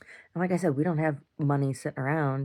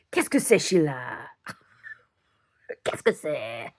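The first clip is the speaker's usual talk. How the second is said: mock French.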